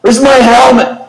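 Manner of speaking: wounded soldier yelling for his helmet; confused yelling. Retold or imitated. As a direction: call out frantically on waking. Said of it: "he starts yelling".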